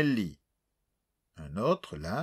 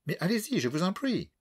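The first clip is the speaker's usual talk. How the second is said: put-on voix douce et aiguë, accent anglais caricatural; vieille Anglaise distinguée; 9 semitones higher than usual. A narrator's reading. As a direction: une mamie britannique charmée qui réclame la suite. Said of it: "voix douce et distinguée". (vieille dame anglaise au ton doux et distingué)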